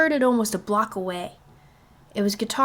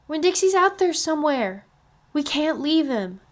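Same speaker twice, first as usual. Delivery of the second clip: put-on high, pleading child voice; a little girl begging her daddy; young girl's voice; 8 semitones higher, 3 dB louder than usual. A narrator's reading. Girl refuses to abandon dog.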